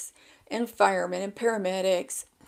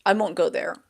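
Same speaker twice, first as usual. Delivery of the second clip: usual speaking voice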